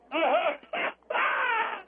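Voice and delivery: high-pitched put-on crying wail; hammed-up wail. Amateur acting the dingo baby's mother, hammed-up wail. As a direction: wail like the bereaved mother in a bad impression. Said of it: "Here's my impression of the lady".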